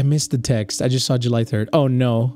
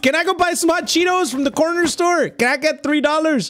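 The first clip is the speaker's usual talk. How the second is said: put-on whiny kid; 10 semitones higher than usual. Whining kid asking mom.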